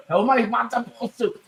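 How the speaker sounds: high put-on Yiddish accent.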